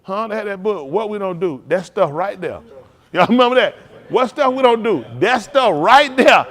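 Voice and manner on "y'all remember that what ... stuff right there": loud, high preaching call, slowing on the end; full-force preaching. Acted. Preacher calling the congregation back to a lesson, loud.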